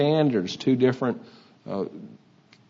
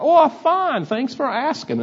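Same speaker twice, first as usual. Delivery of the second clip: very high, eager Texan reply; naive Texan; 3 dB louder than usual. Retold or imitated. Texan in commercial answering a greeting, high-pitched naive Texan. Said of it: "we see somebody from Texas".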